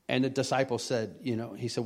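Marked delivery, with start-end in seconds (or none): none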